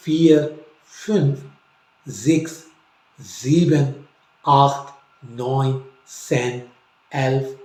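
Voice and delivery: counting नुल to स्वानजिक slowly, word by word; German counting. Shown as a demonstration. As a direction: read German numbers one at a time. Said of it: "number की आवाज़ जो है किस तरह से".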